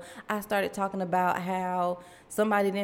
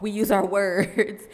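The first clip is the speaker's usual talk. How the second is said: laughing; 5 dB louder than usual. Real, not acted. Laughs mid-quote retelling.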